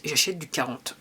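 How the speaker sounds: normal talking voice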